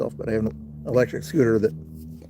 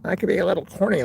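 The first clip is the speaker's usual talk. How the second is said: higher, swooping corny delivery; 6 semitones higher, faster than usual. Shown as a demonstration. Streamer demonstrating a joking voice to chat, corny. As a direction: ham it up with corny, exaggerated inflection. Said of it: "make a corny type voice".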